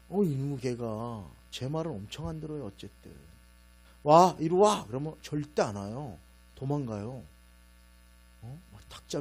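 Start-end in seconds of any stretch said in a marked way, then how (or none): 4.0-4.9 loud stern call to the dog. Retold or imitated. Owner calling the dog, a loud stern call. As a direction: yell it across the room at a dog.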